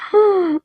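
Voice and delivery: mock shocked gasp, high; acted gasp. Will reacting to Nico's accusation of abandonment, mock shocked gasp. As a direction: draw in a breath as if stung by the accusation.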